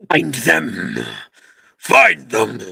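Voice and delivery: loud snarling monster shout; growling voice. Acted. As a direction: bark it out like a hunting spider. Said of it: "You can hear a growling voice come out of her".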